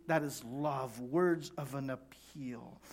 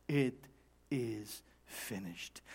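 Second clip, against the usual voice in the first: slow, low, solemn words; hushed finality; 7 semitones lower, 7 dB quieter, slower than usual. Acted. Jesus after receiving the sour wine, slow hushed finality. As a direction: say it softly with gaps between words.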